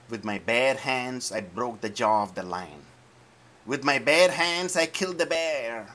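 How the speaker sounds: David building his threat at Goliath. Acted. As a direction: build each phrase to a battle cry.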